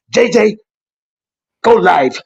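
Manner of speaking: punchy imitation of another man's accent; Garfield's accent. Garfield urging his friend to start streaming, imitated accent. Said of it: "messing with you with this accent".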